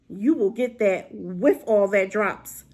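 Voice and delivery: ordinary talk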